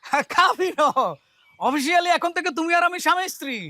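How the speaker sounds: high, excited declaration; excited announcement. Acted. Announces the signed marriage to her. (উঁচু গলায় উচ্ছ্বসিত ঘোষণা)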